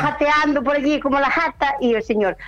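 usual speaking voice

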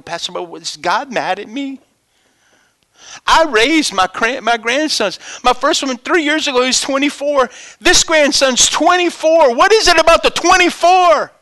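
high, loud anguished plea; grieving woman. Retold or imitated. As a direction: voice her pained question. Grieving grandmother asks pastor.

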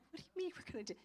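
mocking incredulous scoff; scoffing laugh. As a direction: laugh off his dream. Voicing her doubting reaction.

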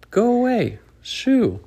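high, loud shoo. Acted. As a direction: chase the bee off. Squirrel shooing the bee.

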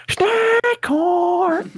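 very high, loud, drawn-out mock scream; mimicked scream. Teller re-enacts screaming it out in class, mimicked scream. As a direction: belt it out as a stretched, piercing imitation. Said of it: "had the integrity to scream".

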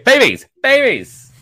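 loud, high-pitched holler; shouted topic announcement. Real, not acted. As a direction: call it out like a headline. Announces the next story topic.

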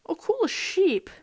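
high, slowed delight at a sheep; excited. Real, not acted. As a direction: perk up, thrilled to see it. Spots a sheep in game.

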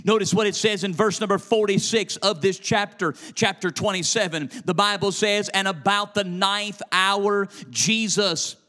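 high, loud, driving sermon delivery; full-force preaching. Real, not acted. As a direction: preach it hard in rhythmic bursts.

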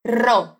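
"ro" with a strongly trilled R; rolled r. Shown as a demonstration. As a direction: roll the R hard on "ro". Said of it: "Esse R do início da palavra é bem vibrante". ("ro" com o R bem vibrante)

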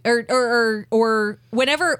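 normal talking voice

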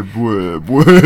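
low rumbling mock grunt; extremely deep bass voice. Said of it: "めっちゃ低い声で".

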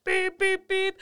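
high, loud mock car horn beeps; imitating angry horn. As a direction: honk at the driver with your voice. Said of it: "And I go".

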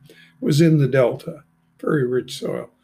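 ordinary talk

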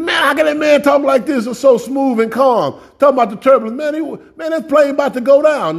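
high, anxious mock passenger fretting; panicky passenger. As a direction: play a nervous flyer gripping the seat. Passenger panicking over the turbulence.

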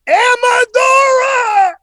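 huge, high, drawn-out scream of a name; top-of-the-lungs holler. Retold or imitated. Preacher calling a dead man by name, full-force holler. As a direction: holler it down the whole plane. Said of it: "I, I hollered".

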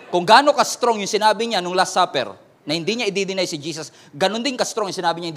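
ordinary talk

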